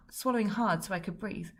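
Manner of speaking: ordinary delivery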